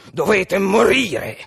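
slow, heavy villain's sentence; menacing Bluebeard. A narrator's reading. Bluebeard tells his wife she must die, menacing. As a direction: draw the threat out grimly.

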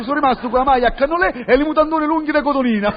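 ordinary delivery

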